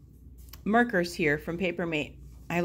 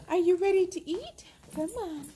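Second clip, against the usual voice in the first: high coaxing pet talk; 9 semitones higher than usual. Real, not acted. Coaxing dogs to eat.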